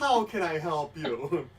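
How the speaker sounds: loud, laughing hotline greeting; hotline operator. Pretend. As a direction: play a giddy call-center operator. Joking hotline greeting routine.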